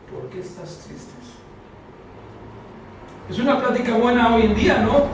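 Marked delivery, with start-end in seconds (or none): 0.1-1.1 much softer, almost breathed; soft tender repeat of the question; soft, tender echo. Acted. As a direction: ask it gently, as if to one sad person.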